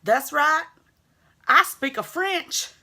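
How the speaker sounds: mock French accent. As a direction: ham up a bit of French for fun. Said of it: "Like my French accent".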